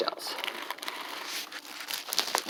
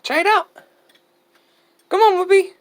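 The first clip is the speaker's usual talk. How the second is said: coaxing pets; faster than usual. Real, not acted. Coaxing a hen to eat.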